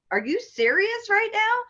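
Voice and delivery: high, rising question in disbelief; retold disbelief, pitch soaring; incredulous. Driver looking at officer after drug accusation, incredulous.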